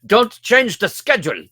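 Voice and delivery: bossy executive order; strict boss voice. Retold or imitated. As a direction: bark it like a strict studio exec. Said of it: "strict on the playbook".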